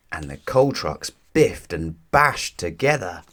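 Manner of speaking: ordinary delivery, nothing marked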